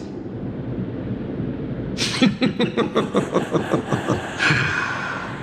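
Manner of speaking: high, rippling laugh; theatrical gloating laughter; villainous laugh. Acted. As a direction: laugh long at your own joke. Villain laughs at own joke.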